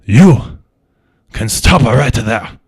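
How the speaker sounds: loud, slow, commanding voice; stern cop voice. Acted. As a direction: stop them cold. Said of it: "somebody turns around and says".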